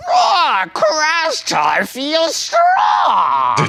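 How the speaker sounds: high, drawn-out strained roar; obnoxious character voice. Pretend. Player boasting as his game monster, in an obnoxious roaring voice. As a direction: bellow it as a ridiculous strongman, stretching every word. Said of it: "get that voice out of here".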